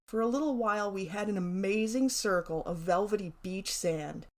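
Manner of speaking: plain reading voice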